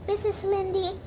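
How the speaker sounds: usual speaking voice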